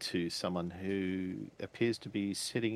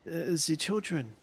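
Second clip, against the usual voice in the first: put-on higher, hurried accented woman; urgent foreign woman; 8 semitones higher than usual. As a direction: urge them to hurry, worried. GM voices Greta.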